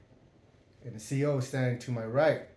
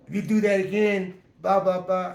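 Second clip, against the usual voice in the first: mock threat; 6 semitones higher, 6 dB louder than usual. Mocking the guard's threat.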